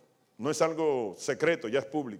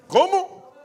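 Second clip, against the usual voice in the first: surprised; 13 semitones higher, 10 dB louder than usual. Real, not acted. Preacher reacts to congregation.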